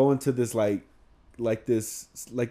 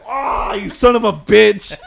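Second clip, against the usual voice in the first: higher, louder half-laughing yell, slowed down; mock shout; 9 semitones higher, 12 dB louder, much slower than usual. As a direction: imitate your own outburst with a laughing shout. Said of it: "And I was like".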